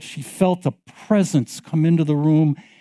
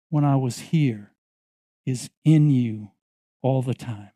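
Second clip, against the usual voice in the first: tender reassurance; 4 semitones lower, 4 dB quieter than usual. Retold or imitated. Jesus reassuring Leanne, low and tender. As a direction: lower and softer, fading intimate reassurance.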